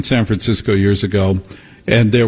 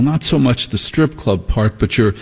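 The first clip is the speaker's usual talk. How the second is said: normal talking voice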